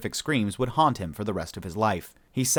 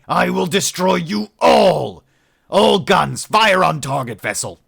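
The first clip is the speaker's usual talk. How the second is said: loud, higher furious yell, slow at first; furious outburst; 9 semitones higher, 11 dB louder, slower than usual. A narrator's reading. Shipmaster loses control and screams the fire order. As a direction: bellow the order to open fire. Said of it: "Herkurtzel's outburst".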